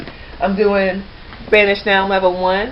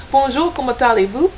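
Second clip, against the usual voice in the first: "Bonjour, comment allez-vous?" said in a French accent, pitched a little higher; French greeting; 6 semitones higher than usual. Shown as a demonstration. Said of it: "Here's, here's my French one".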